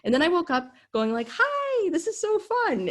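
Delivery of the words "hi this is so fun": put-on very high, sing-song drunk voice; giddy drunk self. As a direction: squeak it out like a dazed happy drunk. Waking up drunk, mocking herself.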